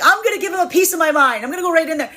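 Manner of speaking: high, loud, fast fired-up rant; marching back in. Retold or imitated. Fed-up self storming back in, worked up. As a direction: storm back in to give him a piece of your mind. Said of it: "marched back into and been like".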